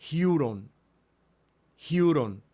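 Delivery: huron with a breathy aspirated start; Greek word huron. Shown as a demonstration. Teacher reading a Greek word aloud to students. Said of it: "porque tiene el acento sobre la upsilon".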